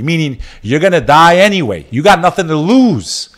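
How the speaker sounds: rousing. Real, not acted. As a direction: fire them up to fight on. Rousing poem message.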